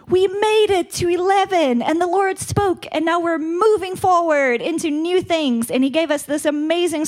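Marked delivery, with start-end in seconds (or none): 0.1-5.7 excited. Retold or imitated. Retelling their excited declaration.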